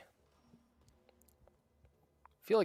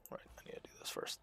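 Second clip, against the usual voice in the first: 1 whisper; 17 dB quieter, faster than usual. Real, not acted. Whispered aside while playing.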